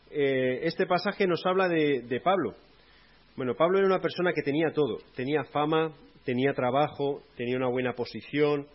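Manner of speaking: loud, driving sermon delivery; fervent preaching. Acted. Preacher proclaims Paul's story to the congregation, loud.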